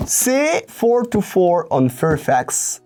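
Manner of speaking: put-on slow, drawn-out American English accent; showy American accent. Vlogger flaunting six years of American university, American accent. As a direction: show off a self-mocking American accent. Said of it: "avec mon magnifique accent de mec qui a fait six ans d'université américaine".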